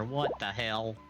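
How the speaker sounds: usual speaking voice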